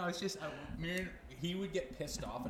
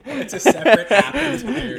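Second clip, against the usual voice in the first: words squeezed out through big laughter; laughing hard; 13 semitones higher, 20 dB louder than usual. Real, not acted. Laughing at his friend's joke about old people's apps. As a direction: laugh while you say it.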